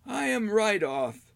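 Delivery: put-on high, slow old hermit; slow proud old man. Old man names himself.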